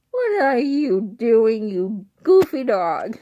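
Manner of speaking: slow, loud teasing call to the dog. Real, not acted. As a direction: drag it out at the silly dog. Waking the dog on stairs.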